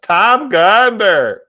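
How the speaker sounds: loud, high, slow name call; light up at a friend's name; delighted recognition. Real, not acted. Streamer spotting his old buddy in chat, loud and delighted.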